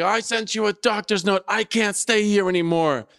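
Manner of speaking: high-pitched mock complaint; half-strength retold screech; imitated screaming tenant. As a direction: mimic an upset caller without really screaming. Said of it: "and screaming".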